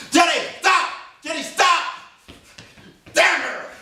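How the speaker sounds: mock rage at a fleeing girl; furious shout.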